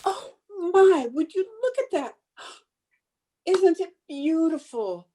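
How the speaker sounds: high, gasping wonder; awed delight. Acted. Hostess shows delivery man the pheasant, awed delight. Marvels at pheasant under glass.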